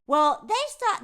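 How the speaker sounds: ordinary delivery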